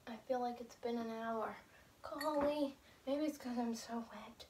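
soft unvoiced whisper. Real, not acted. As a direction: hush it, barely any voice. Whispering during the challenge.

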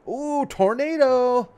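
high, slow, eerie ooh of a teasing dad; mock-ghostly warning, stretched out and loud; spooky dad teasing. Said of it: "he'd be like".

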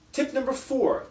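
usual speaking voice

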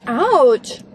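loud sudden ouch; pained ouch. Real, not acted. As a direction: yelp as the tooth hurts. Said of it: "my tooth".

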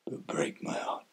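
very low, soft, fading into whisper; hushed heartbreak. Acted. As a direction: sink into a broken-hearted whisper. Resigned close, must stay silent.

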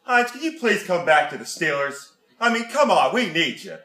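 put-on thick Pittsburgh yinzer accent, dropped grammar, "jeez" fillers. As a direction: play an over-eager Pittsburgh superfan. Comic Pittsburgh superfan character.

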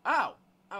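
sudden jump-scare startle; startled gasp. Real, not acted. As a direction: jolt as the scare hits. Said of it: "It was going to fucking jump scare me".

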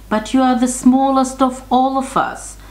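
a hint of pleading goat brothers; worried brother goats. A narrator's reading. Brothers warn the smallest goat, mild high-pitched protest.

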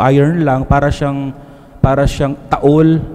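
normal talking voice

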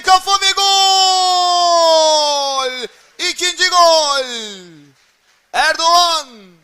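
long drawn-out goal roar; screaming goal call. Real, not acted. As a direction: bellow the goal and the scorer's name. Headed goal, live call.

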